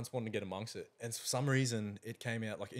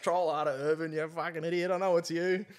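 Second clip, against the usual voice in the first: raised, louder taunt, retold; mock taunting; 6 semitones higher, 5 dB louder than usual. Retelling how he accused his mate of trolling, mock taunting. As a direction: call out the prankster with mock scorn.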